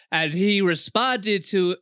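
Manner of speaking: slow put-on American narrator; mock American documentary-style voice; American accent. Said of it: "I don't know why I'm doing American accent".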